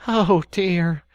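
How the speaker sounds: high, loud exasperated sigh; despairing. Acted. Rat despairing at Mole's obtuseness. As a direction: Rat giving up on Mole. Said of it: "cried the Rat, in despair".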